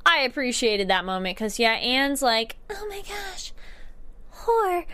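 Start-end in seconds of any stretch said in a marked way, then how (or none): 2.7-4.8 a lightly voiced, much higher gasp, then the word; mock-shocked Anne. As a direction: imitate Anne, scandalised.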